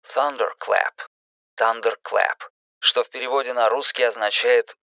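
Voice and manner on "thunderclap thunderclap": a soft, hushed whisper. Acted. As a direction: breathe the word out quietly. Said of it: "Роланд прошептал".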